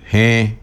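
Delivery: "G" said as hẽ, e through the nose; nasal vowel. Shown as a demonstration. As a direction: affirmative "G" as nasal hẽ. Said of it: "Con la primera es nasal".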